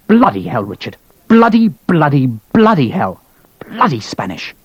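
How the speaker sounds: exasperated officer cursing; angry colonel swearing at the delay; furious swearing. Acted. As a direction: swear it out in a burst of anger. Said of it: "he was furious".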